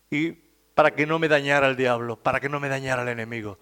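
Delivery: usual speaking voice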